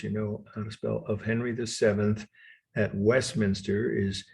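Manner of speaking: ordinary delivery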